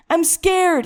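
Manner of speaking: high, desperate outcry; frightened scream. A narrator's reading. Terrified son pleads with his father, screaming. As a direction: scream out the fear. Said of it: "he screamed, sobbing".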